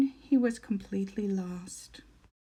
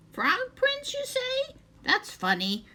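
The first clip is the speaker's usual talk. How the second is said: put-on much higher, a startled old witch squeaking the question; high squeaky witch; 14 semitones higher than usual. A narrator's reading. Second witch hears the words Frog Prince, high and startled. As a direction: pitch way up, a surprised little witch.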